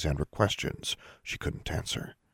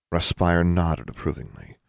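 ordinary delivery

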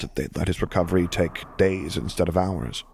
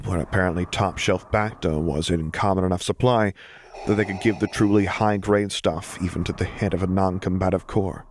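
ordinary delivery, nothing marked

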